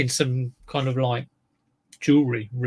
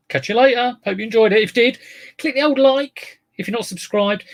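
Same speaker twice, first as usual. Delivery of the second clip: cheery sign-off; 10 semitones higher, 6 dB louder than usual. Acted. Upbeat sign-off to viewers.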